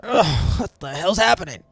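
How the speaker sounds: dazed crewman blinking awake; groggy just-woke-up groan. Acted. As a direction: play him rubbing his eyes, confused. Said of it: "His eyes are like".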